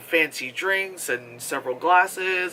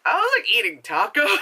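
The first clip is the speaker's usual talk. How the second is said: normal talking voice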